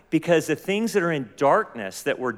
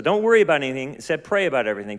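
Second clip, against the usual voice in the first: rapid, run-on verse recap; quick run-through; 3 dB louder, much faster than usual. Acted. Preacher repeating the verse for the congregation, quick and run together. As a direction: rattle through "Instead, pray about everything" without pausing.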